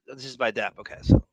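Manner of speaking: ordinary delivery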